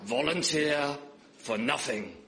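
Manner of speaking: put-on a half-hearted northern Carlisle accent; grandad's Carlisle accent, barely attempted. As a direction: lightly imitate an old Carlisle man. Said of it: "in his Carlisle accent, which I can't really do very well".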